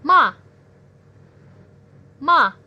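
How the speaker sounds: ma falling sharp and loud; ma with a short forceful drop; fourth tone. Shown as a demonstration. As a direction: stamp ma down from high. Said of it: "turun dan dihentak".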